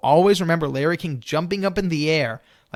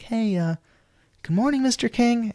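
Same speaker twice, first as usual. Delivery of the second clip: gentle greeting; 9 semitones higher than usual. Retold or imitated. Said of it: "be a bit more gentle".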